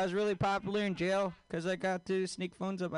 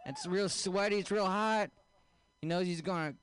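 normal talking voice

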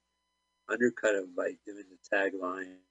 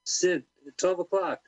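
mock alert call; 8 semitones higher, 4 dB louder, slower than usual. Voicing movie line, alerting.

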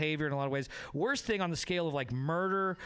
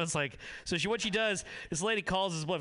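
ordinary delivery

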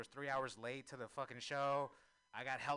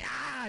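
startled scream; 12 semitones higher, 7 dB louder than usual. Acted. Imagining meeting a midget zombie.

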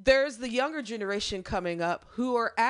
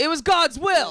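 higher, louder smug pious declaration; mock-pious proclamation; 8 semitones higher, 7 dB louder than usual. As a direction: deliver it like a self-righteous hunter. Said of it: "would shoot those birds and say".

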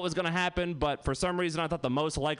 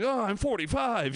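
high, slow comic moan; mock despairing wail; 8 semitones higher, slower than usual. Comic imagining a midlife drug trip, high mock wail. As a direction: wail it in hammed-up middle-aged dread.

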